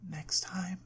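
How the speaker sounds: soft, breathy whisper; breathless whisper. A narrator's reading. Woman catching her breath to her girlfriend, whispering breathlessly. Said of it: "she whispered breathlessly".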